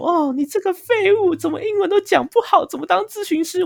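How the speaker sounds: high, scornful troll voice; mocking hater comment. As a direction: sneer it like a nasty commenter. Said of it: "下面有人用评论上面跟我讲说".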